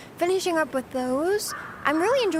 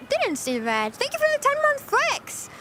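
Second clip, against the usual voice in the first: ordinary delivery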